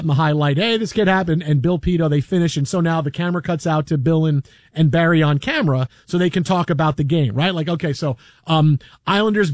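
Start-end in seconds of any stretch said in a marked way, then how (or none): none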